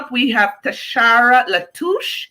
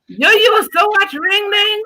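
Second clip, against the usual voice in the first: surprised; 12 semitones higher than usual. Real, not acted. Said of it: "disbelieving teasing question".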